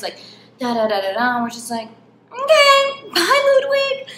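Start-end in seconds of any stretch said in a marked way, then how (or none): 2.3-4.1 much higher, louder sing-song bye; squeaky goodbye. Retold or imitated. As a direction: chirp the goodbye way up high, eager to leave. Escaping Ludwig, high goodbye.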